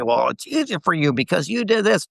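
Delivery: high-pitched mimicry of an excuse; mocking imitation. Guest mimics people who excuse themselves, mockingly.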